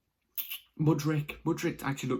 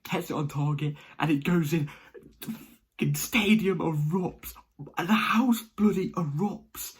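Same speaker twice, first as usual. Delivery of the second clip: building excited commentary, high and loud; 6 semitones higher, 5 dB louder, slower than usual. Retold or imitated. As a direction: relive the goal like it's happening live. Reliving the winning goal.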